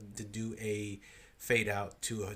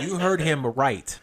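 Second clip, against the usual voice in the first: loud, slow, laughing through it; laughing emphasis; 4 semitones higher, 10 dB louder, slower than usual. Real, not acted. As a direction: hammer it home while cracking up. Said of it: "repeated louder, laughing".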